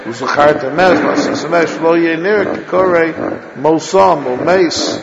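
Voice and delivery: wrong, blurred reading; run together. Shown as a demonstration. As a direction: glide the final m into the aleph with no break. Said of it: "That's what it will sound like if you don't pause".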